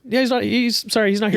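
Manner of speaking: a hint of a rote, robotic staffer. Staff covering for the boss, mock robotic. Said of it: "my staff is, like, robotic".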